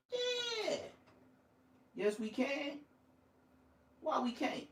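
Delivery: squeaky high mocking yeah; high cartoonish yes voice; mock high-pitched answers. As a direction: play the eager pushover.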